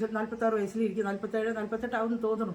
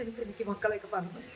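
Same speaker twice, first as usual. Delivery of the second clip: much softer, withdrawn "മക്കളെയൊക്കെ മറന്നു"; mother saying it under her breath, much softer; resigned softness; 7 dB quieter than usual. Shown as a demonstration. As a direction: let "എനിക്ക് മക്കളെയൊക്കെ മറന്നു" sink quietly. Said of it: "ഞാൻ പറയും".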